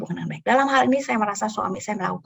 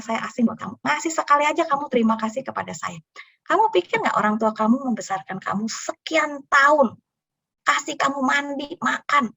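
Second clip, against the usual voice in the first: high, louder lecturing voice; scolding noodle seller; 6 semitones higher, 4 dB louder than usual. Retold or imitated. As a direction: press the boy with every question.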